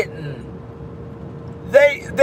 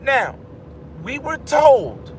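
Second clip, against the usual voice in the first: normal talking voice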